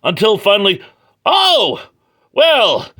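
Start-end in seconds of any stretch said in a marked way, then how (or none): 1.2-2.9 squeaky high Oh, well; high surprised drawl. Retold or imitated. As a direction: pitch way up in amazement. Character finally sees the monster.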